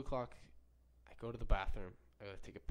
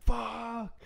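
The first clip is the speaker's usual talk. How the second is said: sharp, much higher, loud curse; angry yell; 14 semitones higher, 6 dB louder than usual. Retold or imitated. Discovering the wet toothbrush, furious yelled curse. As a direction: spit out the curse in fury. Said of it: "I was just like".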